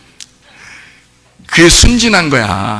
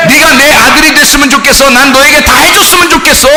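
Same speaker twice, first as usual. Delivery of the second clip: loud-hearted preacher voicing God, raised pitch; God pleading as Father; 7 semitones higher, 3 dB louder than usual. God telling his children what he wishes, impassioned pleading. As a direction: plead insistently to your children in a high voice. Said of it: "하나님 마음을 이해했으면 좋겠다".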